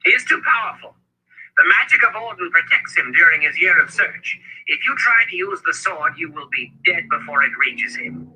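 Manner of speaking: high-pitched elder, urgently explaining danger; Zed's urgent warning. A narrator's reading. Zed shaking Richard as he explains the danger, urgent warning.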